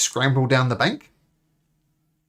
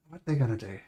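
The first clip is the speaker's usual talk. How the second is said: normal talking voice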